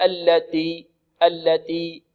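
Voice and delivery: slow "التي" with the t hardened toward d, like "alladi"; breathless t, mistaken. Shown as a demonstration. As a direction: show the wrong "التي", no air released on t. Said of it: "بالتاء بدون همس". (تاء متكلفة بدون همس في "التي")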